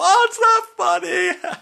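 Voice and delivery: high, loud, slow whine; mock wife whine. Wife scolding the joking husband, high slow mock whine. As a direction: drag it out like a hurt wife.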